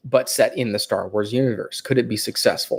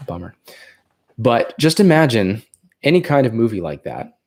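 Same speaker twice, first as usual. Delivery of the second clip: ordinary delivery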